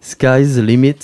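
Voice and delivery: French speaker trying an English phrase, a bit loud; self-mocking English accent. Shown as a demonstration. Interviewer trying the guest's English catchphrase in a clumsy English accent. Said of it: "avec mon accent toujours catastrophique".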